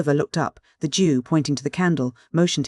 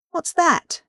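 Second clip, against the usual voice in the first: startled cry. A narrator's reading. Oliver alone hears a rustling noise and cries out, startled. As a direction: jump at the noise and call out. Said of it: "he cried".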